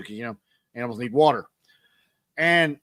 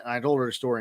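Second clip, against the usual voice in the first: ordinary delivery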